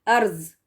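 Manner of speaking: ارض ending in a z; z in place of the Daad in ارض; mistaken z sound. Shown as a demonstration. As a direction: say ارض as "arz". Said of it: "زیڈ کی آواز کے ساتھ".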